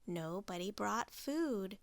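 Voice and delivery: ordinary delivery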